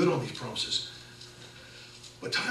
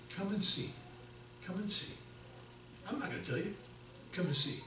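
hushed invitation; 4 semitones higher, 11 dB quieter than usual. Retold or imitated. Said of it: "You remember Philip's words".

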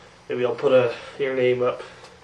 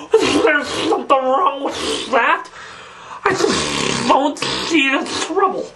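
high, sputtering cartoon lisp; spray the s sounds, pitched up; indignant slushy-lisped cartoon voice; lisping cartoon character; 6 semitones higher than usual.

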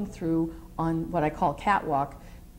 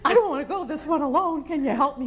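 much higher, pleading little voice; nervous plea; 9 semitones higher than usual. Retold or imitated. Briefer begging colleague for help before facing angry general, high nervous plea. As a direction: plead like you're scared to face him alone.

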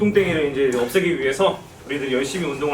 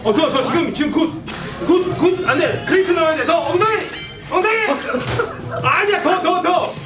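pushy gym trainer; 12 semitones higher than usual. Acted. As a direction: drive the member like a trainer.

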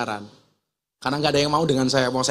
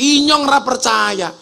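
ngapak man yelling; 7 semitones higher, 4 dB louder than usual. Retold or imitated. Ngapak man refusing to believe the Sundanese man, higher, louder. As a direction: yell in disbelief in ngapak. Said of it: "Orang ngapak langsung teriak".

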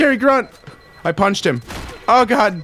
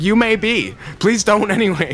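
nervous hostage; 9 semitones higher, slower than usual. Retold or imitated. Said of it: "He's like".

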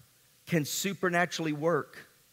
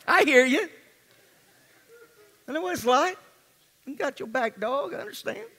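raised pitch, playful put-on sympathy; mock-sympathetic, higher voice; 7 semitones higher than usual. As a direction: tease them with exaggerated understanding. Preacher mocking sympathy to congregation.

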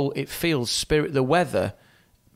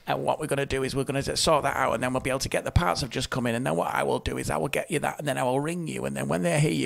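fast, no pauses for breath; breathless rush; much faster than usual. Retold or imitated. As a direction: rattle it off without breathing. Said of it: "They went, ... didn't even take a breath".

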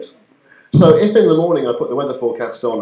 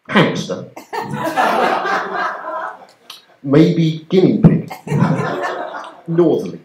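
mock shipping forecast; 4 semitones higher, slower than usual. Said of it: "in my head again".

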